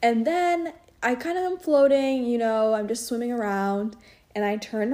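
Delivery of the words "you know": ordinary talk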